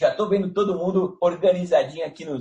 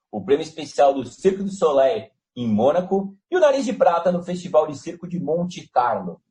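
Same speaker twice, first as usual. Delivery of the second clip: ordinary delivery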